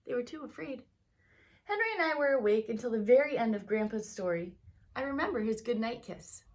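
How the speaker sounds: lively read-aloud storytelling voice; story narration. A narrator's reading. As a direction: tell it like a bedtime story to kids. Teacher reads picture book aloud.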